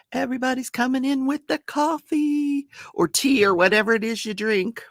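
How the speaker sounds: usual speaking voice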